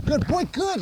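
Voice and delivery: high, bouncy praise; excited dog praise. Real, not acted. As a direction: cheer the dog on in play. Praising dog during play.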